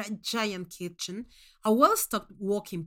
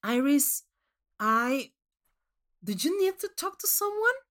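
put-on concerned boyfriend; 5 semitones higher, 3 dB louder than usual. Boyfriend worried by odd request.

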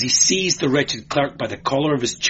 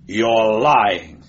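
sharp, louder accusation; snapped; 6 dB louder than usual. A narrator's reading. Inquisitor catching out the clerk, snapping. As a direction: snap it at a witness. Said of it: "snapped Demetrio".

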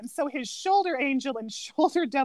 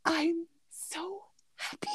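slow, softer contented coo; soft happy sigh; 5 semitones higher, 9 dB quieter, slower than usual. Retold or imitated. Kuzco hugging his pool model, a slow soft coo. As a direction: melt over your own gift.

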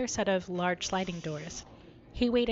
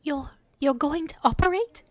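higher, stammering fear; frightened disbelief; quivering voice; 7 semitones higher, 5 dB louder than usual. Acted. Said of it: "Her voice quivered".

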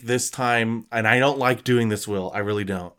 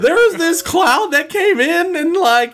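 put-on much higher, louder nervous kid voice; clueless young manager; 5 dB louder than usual. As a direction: play a pimply teen manager who is out of his depth. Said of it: "he's like".

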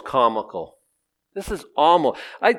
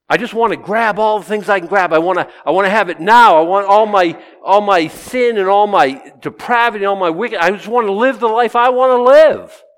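high, loud, insistent rant; mock selfish outburst, pushed high and loud; greedy earth dweller; 7 semitones higher, 9 dB louder than usual. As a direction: rant like someone demanding it all now. Voices greedy, defiant earth dwellers.

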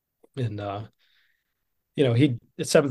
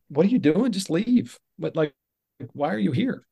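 higher-pitched, incredulous questioning; retold exasperation; 6 semitones higher than usual. Urging rich coworker to leave, exasperated. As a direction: push a friend, baffled, in a high voice.

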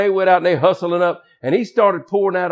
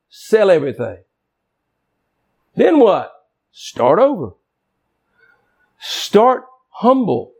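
higher pulpit exhortation; full-force preaching; 5 semitones higher than usual. Acted. Preacher urging debtors to sell everything, fervent. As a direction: press the church to start humble.

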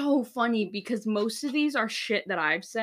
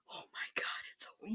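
whisper; 14 dB quieter, faster than usual. Retold or imitated. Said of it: "repeated, whispered".

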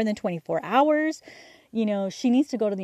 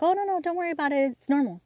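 a hint of a higher, brisk dismissive mom; mom reassuring; 8 semitones higher, faster than usual. Mother telling pregnant daughter not to worry, lightly voiced.

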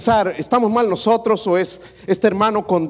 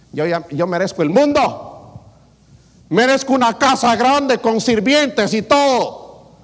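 slow, higher and louder self-important boast; mock entitlement; 6 semitones higher, 3 dB louder, slower than usual. Preacher mocking an entitled believer, slow hammed-up boast. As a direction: puff up like someone owed everything.

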